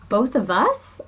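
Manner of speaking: a touch of startled disbelief; shocked question. Acted. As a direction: ask it amazed, voice lifting. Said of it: "I asked in shock".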